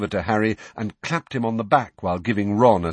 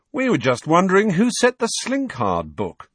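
light chatty tone; conversational; 9 semitones higher, 4 dB louder than usual. A narrator's reading. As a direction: voice Fred casually. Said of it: "said Fred conversationally".

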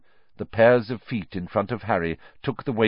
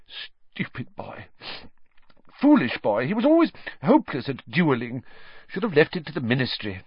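said thickly; 8 semitones higher than usual. Acted. Grieving teacher scolds the dead boy, thick and choked. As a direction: speak through a blocked nose after crying. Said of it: "blew her nose and said thickly".

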